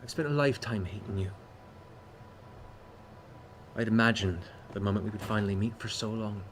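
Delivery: hushed, halting hurt daughter; estranged daughter. Acted. Daughter confronting the father she spent a lifetime hating, subdued.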